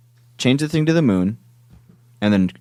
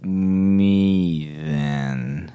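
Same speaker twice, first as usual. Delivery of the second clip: extremely slow, dragged-out words; really slow; much slower than usual. Shown as a demonstration. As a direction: stretch every syllable out. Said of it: "let's talk really slow".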